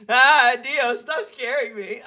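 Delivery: giggling through the words; laughing. Real, not acted. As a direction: laugh while protesting. Laughing at own scare.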